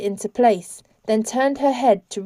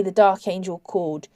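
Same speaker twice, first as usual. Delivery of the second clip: ordinary delivery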